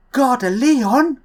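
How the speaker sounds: slow, high, rising Gare de Lyon; French announcement, rising tone. Pretend. A vlogger imitating the French two-tone station chime, rising. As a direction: draw out Lyon and lift it up at the end. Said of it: "two different voice tones".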